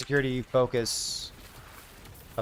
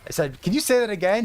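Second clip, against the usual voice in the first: higher, louder impressed reaction; retold astonishment, pitched up and louder; amazed dad; 7 semitones higher, 4 dB louder than usual. Dad asking his toddler to repeat, amazed.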